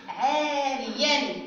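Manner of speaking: عاريا very slowly as "aaa-riyan"; قراءة تعليمية. Shown as a demonstration. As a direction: stretch the first vowel of عاريا, ending in -an. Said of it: "رددوا معي مرة أخرى". (قراءة «عاريًا» ببطء مع مدّ الألف)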